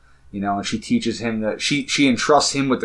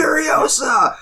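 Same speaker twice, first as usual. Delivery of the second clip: crowd cheer; slower than usual. Retold or imitated. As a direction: cheer the name much higher, like a crowd. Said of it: "And they're like".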